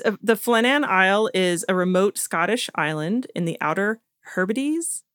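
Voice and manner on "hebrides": "Hebrides" drawn out with a rising question; unsure questioning rise. Real, not acted. Host stumbling on the island name, "Hebrides" as unsure question. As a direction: say "Hebrides" slowly, as a guess.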